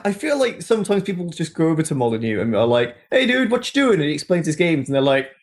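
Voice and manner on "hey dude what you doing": high, lightly imitated friendly passer-by; casual greeting. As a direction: play someone casually saying hi, pitched up. Acts out people greeting Molyneux.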